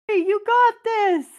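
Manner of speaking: high, loud cheer upward; encouraging shout. Retold or imitated. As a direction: yell encouragement up the stairs. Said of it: "he goes".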